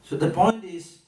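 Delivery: normal talking voice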